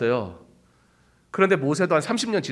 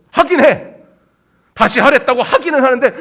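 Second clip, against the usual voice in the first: put-on loud, higher, huffing sulky teen; heated mimicry; 8 semitones higher, 6 dB louder than usual. Preacher acting out a sulky child, heated. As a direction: act out the grudging teen.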